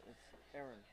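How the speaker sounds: "Aaron" said the New Zealand way; New Zealand pronunciation. Shown as a demonstration. Commentator explaining his name to colleague, New Zealand pronunciation of "Aaron". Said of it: "in New Zealand".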